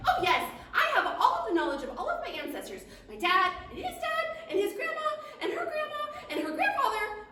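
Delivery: high singsong list through giggles; laughing dragonfly. Acted. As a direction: chirp the family tree out laughing. Dragonfly proudly lists ancestors.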